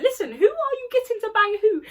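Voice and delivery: high, loud imitation of a challenge; mock outraged demand; confrontational. Acted quarrel between classmates.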